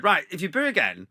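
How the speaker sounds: comic ultimatum from the stage; retold threat. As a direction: threaten the hecklers, half in jest. Threatens the booing crowd from stage.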